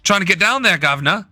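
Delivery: mock British accent. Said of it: "if you got the Brits going".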